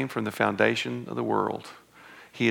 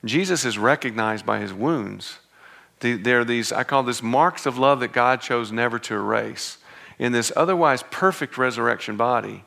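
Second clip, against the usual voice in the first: ordinary delivery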